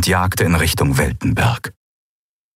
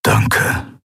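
voiceless, low thanks; hushed farewell. Acted. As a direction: thank them almost in a whisper. Keru's hushed farewell before leaving. (leise, fast tonlos)

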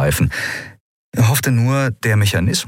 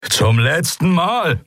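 slow, raised final warning; commander's warning; 9 semitones higher, slower than usual. A narrator's reading. Commander loses patience with armed pirates, slow high warning. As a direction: warn them, high and drawn out. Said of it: "setzte der Kommandant an".

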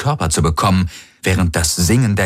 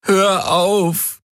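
high, whimpering plea; pleading whimper, much higher. A narrator's reading. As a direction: whimper it, broken by pain. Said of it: "wimmerte er".

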